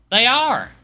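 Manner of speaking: high, chatty hostess character; friendly roommate. Acted. Roommate telling a guest about breakfast, friendly and high.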